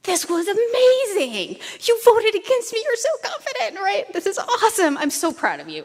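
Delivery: high, delighted gushing voice; imitating Jean's thrilled praise, high and loud; excited praise. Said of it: "she turns to me and she goes".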